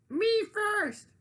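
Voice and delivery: high, loud greedy piglet cry; pushy pig crying out. Acted. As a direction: shout it like a pig who must be first. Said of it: "rang out".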